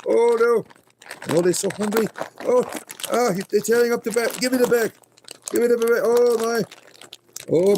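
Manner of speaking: high excited squeals over the bag; frantic, laughing panic. Real, not acted. Rabbits tearing the treat bag.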